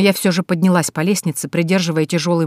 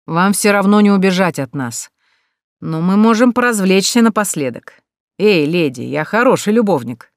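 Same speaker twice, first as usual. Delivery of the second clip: louder, jeering bandit taunt; a lightly voiced bandit, louder and mocking; leering threatening thug; 2 semitones higher, 4 dB louder than usual. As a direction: taunt the lady from below, leering. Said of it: "угрожающий мужской голос".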